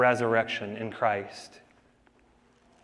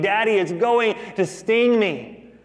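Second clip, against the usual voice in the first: panicked scream; 8 semitones higher, 4 dB louder than usual. Retold or imitated. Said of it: "the girl is screaming, and she's panicking".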